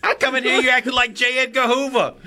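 very high mock complaint; put-on character voice. Co-host acts out a complaint for laughs, very high character voice. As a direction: squawk it high, as a comic character.

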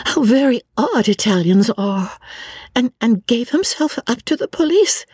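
put-on a nervous young woman filling the silence; lightly voiced anxious chatter; Lucy chattering nervously.

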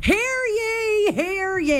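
high proclaiming crier call; town crier. Acted. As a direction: announce it to the village square. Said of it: "can't you see him doing it too".

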